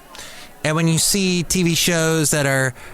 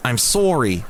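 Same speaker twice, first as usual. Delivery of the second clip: sorry said as "SORE-ee", Canadian style; Canadian accent. Retold or imitated. Imitating Canadians telling him they're sorry, Canadian accent. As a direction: give sorry a rounded Canadian O. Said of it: "if you're Canadian".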